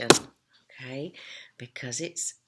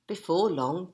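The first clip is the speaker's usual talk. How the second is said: plain reading voice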